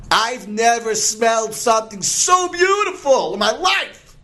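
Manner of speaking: high, over-the-top flattery; gushing sheep. Acted. Sheep flattering the lion to save herself, gushing. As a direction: gush in a high put-on voice.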